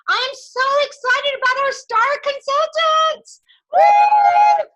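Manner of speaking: big excited build into a loud whoop; from breathy excitement to a whooping cheer. Real, not acted. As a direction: hype the room up, then let out a cheer. Cheering on star consultants.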